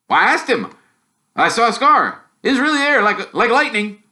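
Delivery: high, loud, boasting; a kid proud of his discovery; eager boy twin. Acted.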